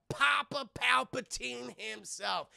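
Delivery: slow, high drawn-out reveal; mock grand announcement. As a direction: announce the big name like a showman.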